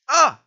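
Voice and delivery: short, loud, high yelp; sudden cry of alarm; startled yell. Real, not acted. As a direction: yelp as if just hit. Player hit during game fight.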